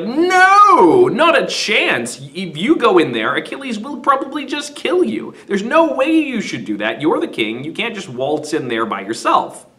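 high, loud mock wife's refusal; Hecuba refusing. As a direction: put your foot down as the worried queen. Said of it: "Hecuba's like".

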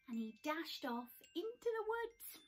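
ordinary delivery, nothing marked

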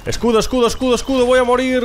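frantic pleading. Real, not acted. Panicked begging mid-fight.

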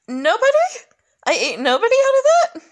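high, loud disbelieving cry; yelping in disbelief at being eaten; shocked outburst. Real, not acted. Gamer yelps at the game in shock.

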